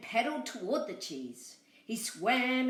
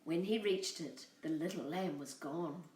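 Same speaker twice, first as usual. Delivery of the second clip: usual speaking voice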